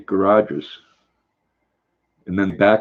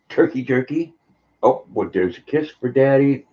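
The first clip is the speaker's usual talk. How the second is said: pet voice; 5 semitones higher than usual. Real, not acted. Talking to the dog.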